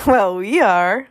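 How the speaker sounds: put-on loud kid, each word dragged out; Screecher's kid voice. A narrator's reading. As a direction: stretch it out as a boastful little boy. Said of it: "went Screecher".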